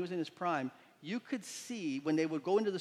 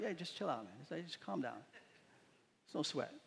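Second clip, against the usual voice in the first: soft, easygoing reassurance; calm a panicking friend down, softly; casual calm; 8 dB quieter than usual. Retold or imitated. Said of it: "Elisha just kinda casually says".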